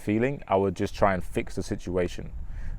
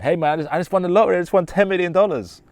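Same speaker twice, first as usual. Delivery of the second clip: higher, louder, bursting with excitement; excited lottery news; 9 semitones higher, 4 dB louder, faster than usual. Shown as a demonstration. Telling a friend about winning the lottery, excited. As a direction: blurt out the big win. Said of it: "And I tell my friend".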